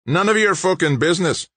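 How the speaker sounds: high-pitched angry snarl. A narrator's reading. Big George rebuffing the stranger's question, snarling. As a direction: snarl it to shut him down. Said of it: "George snarled".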